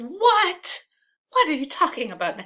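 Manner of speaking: high, loud outburst, then quick; startled, rapid-fire demand; incredulous. Acted. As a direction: react in acted disbelief. Brabantio's shocked reaction, acted.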